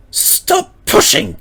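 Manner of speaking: high, slow voice for Mavis, growling at the trucks; growled. A narrator's reading. Mavis scolding her trucks, high growled order. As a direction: snap at the trucks like a cross little engine. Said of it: "she growled".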